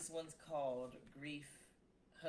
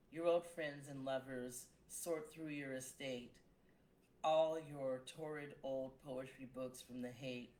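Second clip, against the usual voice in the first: measured elegy reading; poem reading; 7 dB louder, slower than usual. Acted. Poet reading an elegy for a friend, measured. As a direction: read it slow and steady, listing each thing.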